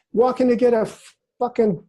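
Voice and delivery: sudden excited blurt with a swear; excited outburst. Real, not acted. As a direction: blurt it out, carried away. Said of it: "did I say that out loud?".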